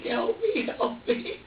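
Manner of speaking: high pleading little voice; imitating the baby's plea. Father voicing his baby's silent plea, high and pleading. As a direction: voice the baby begging for help. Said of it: "he's just looking at me like".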